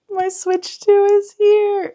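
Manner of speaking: high, loud and slow, bursting with glee; excited announcement. Real, not acted. Vlogger tells viewers the Switch arrived, thrilled.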